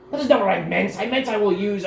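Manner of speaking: a pampered lord sputtering at the insult; outraged noble. Acted. Captured noble to Cecil, high indignant outrage.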